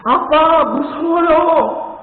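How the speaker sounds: put-on high, slow frightened child's plea; scared child. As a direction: whimper it up like a boy stuck in a tree. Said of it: "그러자 아들이".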